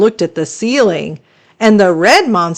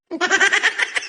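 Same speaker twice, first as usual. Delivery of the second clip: soft high snicker; a quick stifled giggle; child snickering at the monster; 12 semitones higher, 6 dB quieter than usual. Acted. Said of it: "I snickered".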